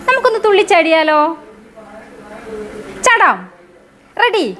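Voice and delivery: high, fast and bouncy; teacher cheering the kids to jump; excited invitation. Acted. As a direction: pitch it up like a game. Teacher invites class to hop.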